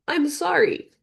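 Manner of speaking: put-on high girlish apology; small high voice saying sorry; girl's apology. Young girl apologizing.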